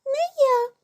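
very high little mouse refusal; high-pitched storybook squeak; squeaky mouse. A narrator's reading. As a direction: pipe it up high like a tiny mouse. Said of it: "гукає круть".

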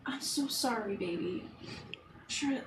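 whispered sympathy; tender consoling. Acted. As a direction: console a partner very softly. Wife consoles husband in character.

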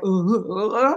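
mock inarticulate grunts; mocking his way of talking. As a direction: imitate a man stumbling over sounds. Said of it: "even the way he talks".

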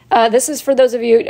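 usual speaking voice

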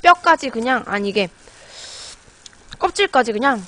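chewing while talking; biting crab shell; mouth full of crab. Real, not acted. Seen 0: Bites and chews crab pieces held to mouth throughout the words.

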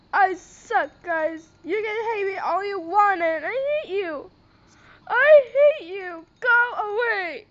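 loud, tearful angry outburst; upset yelling. Real, not acted. Vlogger telling haters to go away, yelling in anger. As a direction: yell it out, hurt and furious.

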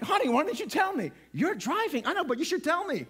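high, whiny mock bickering; mock blaming. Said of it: "I start blaming my wife".

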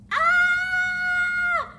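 playful yelp. Acted. Coach yelps during fast rolls.

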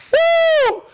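high, loud joyful whoop; ecstatic woo. Real, not acted. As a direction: let out a joyful praise shout. Preacher's ecstatic cry.

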